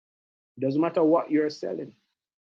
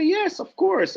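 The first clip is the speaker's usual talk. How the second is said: eager friend; 13 semitones higher, slower than usual. Retold or imitated. Voicing the friend's eager answer.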